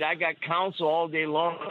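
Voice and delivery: ordinary talk, nothing marked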